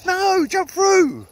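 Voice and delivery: high, loud, drawn-out order; loud slow command. Real, not acted. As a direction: bellow it slowly at the dog. Commanding the dog at the hoop.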